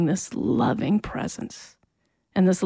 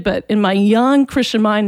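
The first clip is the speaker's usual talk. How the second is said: normal talking voice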